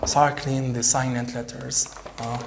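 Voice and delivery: ordinary talk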